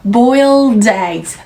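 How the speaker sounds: "boiled eggs" slowed right down, the d gliding into eggs; slow linked phrase. Shown as a demonstration. Teacher slowing down so students hear "boiled eggs" connect. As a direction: draw "boiled eggs" out slowly so the link is heard. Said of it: "바로 eggs".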